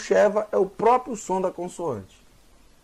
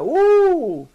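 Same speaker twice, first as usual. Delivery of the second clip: playful whoop; 13 semitones higher, 6 dB louder than usual. Acted. Vowel U sliding down.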